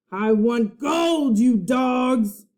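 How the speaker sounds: slow, loud pirate growl; pirate demand. A narrator's reading. Captain Bones demanding gold from crew, slow loud pirate snarl.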